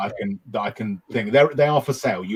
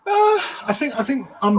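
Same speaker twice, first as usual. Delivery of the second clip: squeaky falsetto, much higher; very high pitched; 8 dB louder than usual. Acted. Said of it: "that was a very high pitched".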